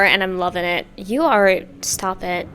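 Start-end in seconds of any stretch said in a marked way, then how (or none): none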